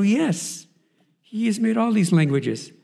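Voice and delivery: joyful son telling his father God speaks Spanish; excited reassurance. Retold or imitated. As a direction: cheer him on, loud and high. Son continues exuberant.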